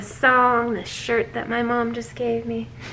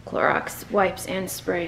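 ordinary talk

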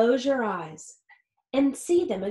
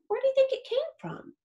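curious question; faster than usual. A narrator's reading. Asking the child a question.

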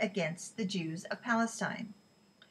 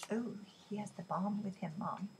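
much softer urgent aside; hushed aside; 9 dB quieter than usual. A narrator's reading. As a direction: drop to a worried hush.

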